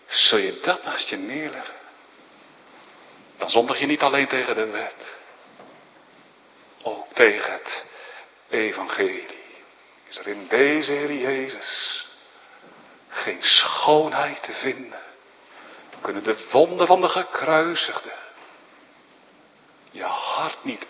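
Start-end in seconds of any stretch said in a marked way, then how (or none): none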